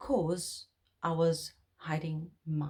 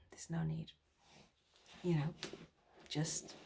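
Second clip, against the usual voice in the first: hushed, intimate whisper; 3 semitones lower, 13 dB quieter than usual. Real, not acted. Advising viewers not to be prisoners, whispered. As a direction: breathe it to the viewer.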